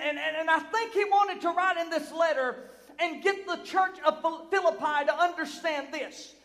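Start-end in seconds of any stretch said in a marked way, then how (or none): none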